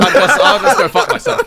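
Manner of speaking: very high, laughing yelp; laughing outburst. Real, not acted. Laughing high after friends forget him in the lineup. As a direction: laugh it out in mock outrage.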